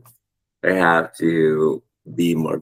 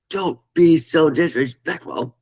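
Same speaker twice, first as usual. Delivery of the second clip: higher, sharp scolding of his son; 11 semitones higher than usual. Retold or imitated. Father retelling how he scolds his angry son. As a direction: snap at the boy in mock anger. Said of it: "And I'm like".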